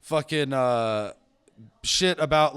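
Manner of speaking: ordinary talk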